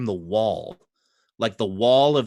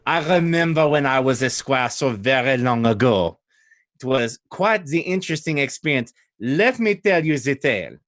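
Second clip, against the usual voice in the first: put-on chatty French-accented storyteller; 3 semitones higher than usual. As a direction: launch into a long tale in a French accent. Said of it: "French in this case would be Old Adari".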